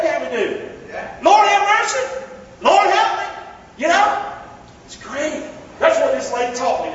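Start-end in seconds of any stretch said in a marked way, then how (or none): 1.2-3.2 loud prayer. Shown as a demonstration. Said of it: "we can pray that".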